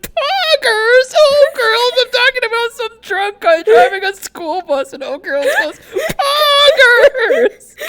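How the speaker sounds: very high, loud, laughing squeal; Kitty laughing impression. Imitating Kitty, who is amused at chat yelling poggers, a high laughing shriek. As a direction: shriek it out cracking up, ending in a shout. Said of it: "He's like".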